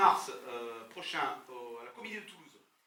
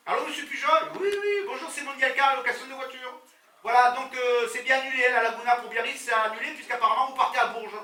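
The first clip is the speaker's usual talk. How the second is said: rental agent voice; 8 semitones higher, 10 dB louder than usual. Retold or imitated. Acted car rental agent on the phone.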